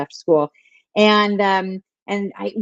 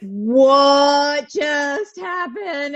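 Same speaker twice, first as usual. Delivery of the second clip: put-on slow, high child asking in shock; stunned child; 7 semitones higher, much slower than usual. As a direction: play the bewildered little kid. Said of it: "my kids are like".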